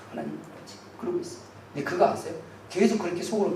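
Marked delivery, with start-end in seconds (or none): 0.1-1.5 whispered prayer. Acted.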